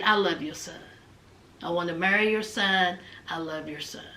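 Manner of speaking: soft, low, tearful pleading; tearful plea. Retold or imitated. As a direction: beg softly, on the edge of tears. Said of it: "Memphis starts crying".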